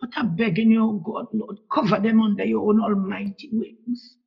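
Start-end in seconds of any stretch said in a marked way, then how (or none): none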